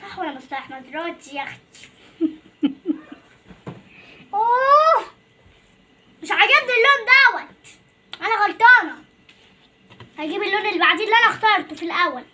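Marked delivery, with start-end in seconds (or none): 4.3-9.0 high, loud cry of dismay over a mistake; sudden loud frustrated outburst. Real, not acted. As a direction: exclaim loudly, upset at herself.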